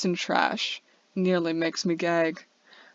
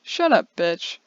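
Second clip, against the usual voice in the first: angry shout; 5 dB louder, slower than usual. Acted. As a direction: bark at her to shut up.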